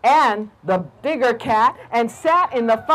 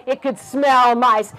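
usual speaking voice

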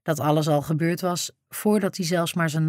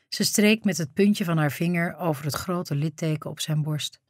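ordinary delivery, nothing marked

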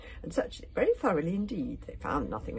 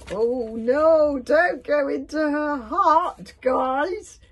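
loud, high, drawn-out plea; alarmed warning; 10 semitones higher, 14 dB louder, much slower than usual. Acted. Begging the dragons to avoid the hut, wailing. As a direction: wail the warning out slowly. Acted warning among story voices.

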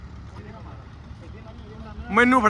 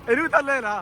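usual speaking voice

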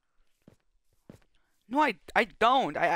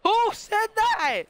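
squeaky much higher and louder, slow; terrible impression; 7 dB louder, slower than usual. Friend mocking someone in voice chat, silly slow squeak. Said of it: "That's a terrible impression".

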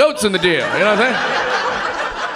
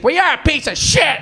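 much higher, louder mock outburst; hammed-up furious shout; angry lady yell; 10 semitones higher, 3 dB louder than usual. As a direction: shout it like an outraged churchgoer. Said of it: "And she's like".

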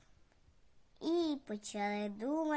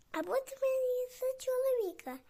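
higher, sweet little fox voice; sly fox; 9 semitones higher than usual. Acted. Fox proposes marriage to the cat, high and coaxing. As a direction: coax him in a high wheedling tone. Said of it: "Лисичка каже".

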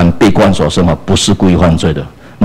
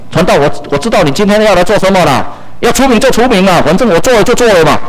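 defiant brother; 10 semitones higher, faster than usual. Retold or imitated. Erring brother answers the preacher.

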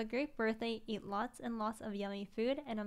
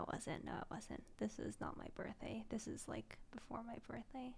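whispered to herself; 9 dB quieter than usual. Real, not acted. Correcting herself under her breath.

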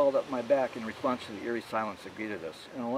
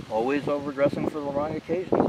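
dry, louder wisecrack; quipped; 4 semitones higher, 6 dB louder than usual. A narrator's reading. Narrator quipping at Callie's overdressed entrance. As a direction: toss it off with a smirk. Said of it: "I quipped".

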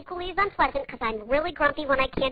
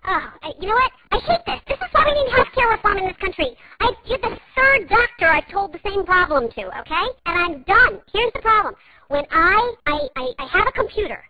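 higher and louder, fed-up complaint; exasperated rant; 6 semitones higher, 4 dB louder than usual. Acted. Caller vents at the tech support agent, higher and louder. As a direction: let the frustration boil over.

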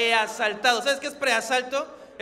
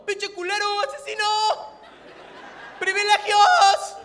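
high-pitched mock-angry heckle; exaggerated high accusing yell; heckling outrage; 10 semitones higher, 6 dB louder than usual. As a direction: hurl it like an outraged crowd member, ending loud and stretched. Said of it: "si no es como".

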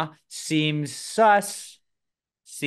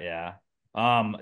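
ordinary talk

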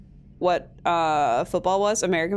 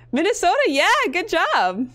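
excited congratulation; 9 semitones higher, 4 dB louder than usual. Real, not acted. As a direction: celebrate their correct guess. Cheers viewer's correct guess.

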